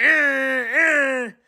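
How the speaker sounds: high loud honking; car horn. Acted. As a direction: honk back like a truck. Truck honking back.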